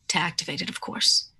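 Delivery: hushed matter-of-fact aside; knowing whisper. Acted. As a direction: whisper it as an obvious secret. NPC explains how the stone works.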